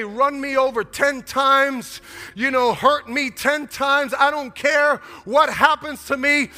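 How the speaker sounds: loud, high, urgent declaration; impassioned parent. Acted. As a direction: plead fiercely for your child's life. Voicing a parent's desperate devotion.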